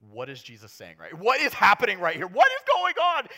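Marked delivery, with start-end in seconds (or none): 1.1-3.3 much higher, louder confused cry; baffled outcry. Pretend. A bewildered Bible reader crying out, high and loud. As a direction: throw up your hands in confusion.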